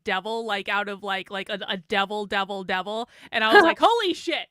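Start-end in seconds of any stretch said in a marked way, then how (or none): 3.8-4.5 higher, louder "wow" reaction; retold amazement. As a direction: exclaim it in amused shock. Retold shock at passing devil.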